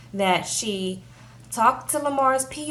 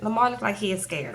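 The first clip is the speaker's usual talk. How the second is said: ordinary talk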